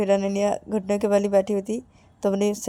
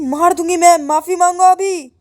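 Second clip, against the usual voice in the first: high, loud command, slowing at the end; angry threat; 8 semitones higher, 10 dB louder than usual. Acted. Girl ordering her friend to apologise, angry threat.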